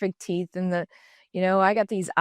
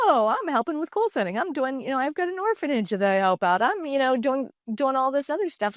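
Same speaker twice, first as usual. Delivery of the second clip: higher, indignant listing of good deeds; defensive protest; 6 semitones higher than usual. Retold or imitated. Defending herself to the coach who called her selfish, indignant. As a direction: protest as if wrongly accused.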